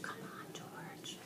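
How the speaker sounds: breathy unvoiced whisper; a tense boy whispering encouragement. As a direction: urge him on in a whisper. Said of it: "he whispered to himself".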